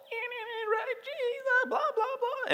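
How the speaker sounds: absurdly high squeaky pious babble; hammed-up fake-holy squeak; mock pseudo-spirituality. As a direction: parody empty church talk in a tiny shrill squeal. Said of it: "we got our pseudo spirituality".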